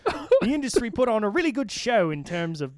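high, loud stab at an Australian accent; attempted Australian accent. Shown as a demonstration. Host reading senator's quote on co-hosts' dare, attempted Australian accent. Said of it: "you have to read it in an Australian accent".

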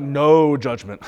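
drawn-out, knowing no judgment; slow wry aside. Retold or imitated. Parent telling other parents, slow wry no judgment. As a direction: stretch it out like a tired parent. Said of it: "I'm like".

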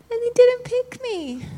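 very high, plaintive mock whine. Preacher retelling another rejection, high mock whine. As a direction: squeak it out like someone left out. Retelling being passed over a second time.

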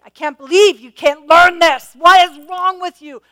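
loud, high, exasperated scolding; scolding parent. Pretend. A parent scolding a child who fell, loud mock scolding. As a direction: scold a toddler who keeps falling. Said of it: "I didn't scold them and say".

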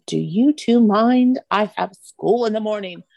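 put-on slow, put-upon child's scolding; imitated scolding nine-year-old. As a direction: imitate an indignant little girl chastising her parents. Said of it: "chastised by our nine-year-old".

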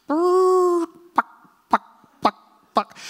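high clucking chicken sounds; chicken noises. Retold or imitated. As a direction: cluck like a chicken over the radio. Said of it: "make chicken noises".

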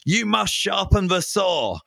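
a hint of a stern Austrian boss; Austrian accent. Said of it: "in his Austrian accent".

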